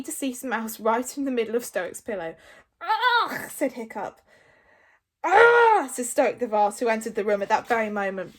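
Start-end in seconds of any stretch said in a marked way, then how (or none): 2.8-3.5 loud, high "ugh"; high-pitched noise of disgust; disgusted groan. A narrator's reading. Disgust at mess on pillow. 5.2-5.9 high, full-volume "ah"; big appalled yell; loud horrified cry. A narrator's reading. Stoic walks in on the mess.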